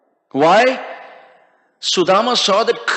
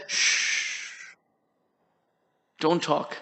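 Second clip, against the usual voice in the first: long shush, then soft low words; gentle hushing; 5 semitones lower, 12 dB quieter, much slower than usual. A narrator's reading. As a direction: hush an excited friend softly. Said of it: "Sudama started talking out of enthusiasm".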